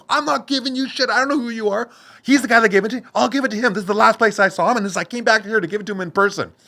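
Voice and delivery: high, loud defiant refusal; heated retold refusal to the dealer. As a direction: stand your ground, raising your voice.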